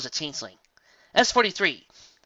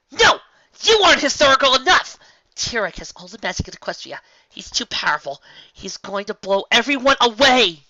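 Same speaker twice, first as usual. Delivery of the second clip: much higher, loud furious shout; 11 semitones higher, 13 dB louder than usual. A narrator's reading. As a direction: Twilight snaps back after the slap. Twilight shouts back.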